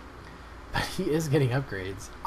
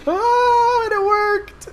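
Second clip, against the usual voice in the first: very high, loud joyful cry; delighted surprise; 10 dB louder, much slower than usual. Real, not acted. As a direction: burst out in triumph. Trick worked.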